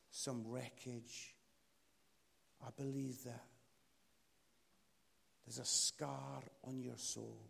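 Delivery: low, soft, long solemn pauses; hushed pastoral invitation, phrase by phrase; altar-call prayer. Real, not acted. Preacher to bowed heads about brokenness, softly prayerful.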